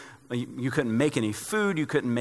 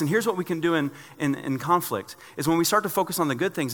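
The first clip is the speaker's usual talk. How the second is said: ordinary talk, nothing marked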